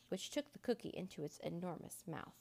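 ordinary delivery

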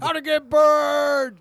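slow booming shout; slow drawn-out shout. Pretend. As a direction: bellow it out slowly, high and loud. Shouted catchphrase repeat.

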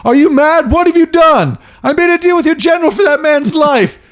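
very high, indignant protest; Christoph Waltz. Acted. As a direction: demand answers in a high, scandalised voice. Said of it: "it's Brad Pitt and Christoph Waltz".

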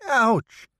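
high sharp cry of pain. Acted. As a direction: yelp as the pain hits. Head wound pain.

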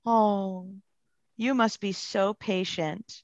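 poor-you tone, gently overdone; mock sympathy. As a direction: imitate a gushing sympathizer, drawn out and hushed. Mimics pitying reaction to job.

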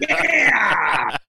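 loud, high, joyful whoop; triumphant yell. Real, not acted. Cricketer celebrating the umpire's call with a loud high whoop. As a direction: shout it out like you just survived.

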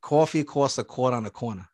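lightly demonstrated New York accent. Shown as a demonstration. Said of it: "say it one more time".

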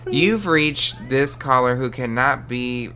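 slow answering machine voice; voicemail greeting. Pretend. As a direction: read it like a recorded greeting, slow.

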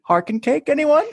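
high, rising hostess offer; mock panicked politeness. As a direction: imitate someone offering cake while panicking inside. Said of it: "freaking out inside and just goes".

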